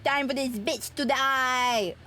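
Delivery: normal talking voice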